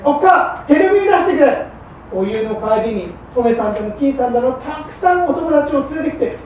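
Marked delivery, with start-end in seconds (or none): 0.0-1.6 imitated bossy call. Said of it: "wife quoting husband".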